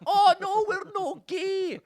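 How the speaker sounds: very high, drawn-out Scottish squeal; mock Scottish accent. Scots protesting to onlookers, high mock Scottish accent. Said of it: "And they're like".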